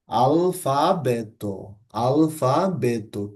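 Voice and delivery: very slow "আলফাবেতো", final তো dental, never টো; soft তো ending. Shown as a demonstration. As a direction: spell it out slowly, dental তো. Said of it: "টো বলছি না আমি কিন্তু". (খুব ধীরে "আলফাবেতো", শেষে দন্ত্য তো)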